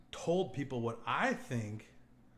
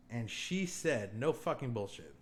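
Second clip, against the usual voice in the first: normal talking voice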